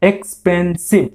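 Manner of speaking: এক্সপেন্সিভ broken into three separate beats; এক্সপেন্সিভ split up to count its syllables. Shown as a demonstration. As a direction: say এক্সপেন্সিভ in three parts, ex-pen-sive. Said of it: "তিনবারে বলছো".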